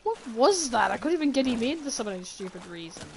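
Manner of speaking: loud, incredulous complaint; exasperated outburst. Real, not acted. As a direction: exclaim in frustration, then ease off. Outburst after struggle with fish.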